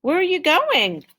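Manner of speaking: high, loud call after a friend walking off; calling after him. Acted. Mike calls after Tim heading outside, loud high call. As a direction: shout after him so he turns. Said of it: "Mike called after him".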